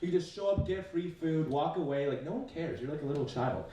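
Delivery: usual speaking voice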